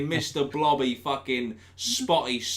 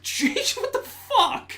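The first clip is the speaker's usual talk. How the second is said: much higher, laughing mock panic; shocked; 7 dB louder, much slower than usual. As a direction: squeal it in laughing disbelief. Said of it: "I was like".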